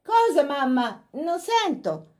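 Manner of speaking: calling upstairs. A narrator's reading.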